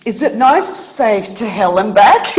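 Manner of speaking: a rueful laugh through the words. Real, not acted. Guest jokes with the host about her past, laughing. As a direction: laugh off a painful past as you say it.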